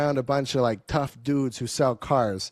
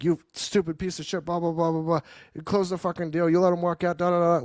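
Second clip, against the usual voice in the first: high, harsh mock tirade from the boss; retold manager yelling; 7 semitones higher than usual. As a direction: rattle it off like a furious sales manager. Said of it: "he's like".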